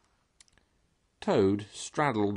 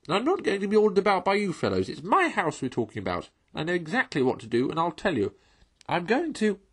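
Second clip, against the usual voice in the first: high, loud and fast, worked up; excited toad ranting faster; getting excited; 9 semitones higher, 3 dB louder than usual. A narrator's reading. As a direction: rattle it off, refusing orders. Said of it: "getting excited".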